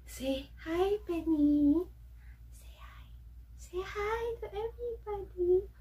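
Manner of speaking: high-pitched coo to a dog; pet-owner coaxing. Real, not acted. Coaxing her dog to greet viewers.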